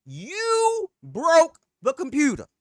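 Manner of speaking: furious accusation; venting at iBuyPower in retold anger; heated outburst. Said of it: "I'm heated".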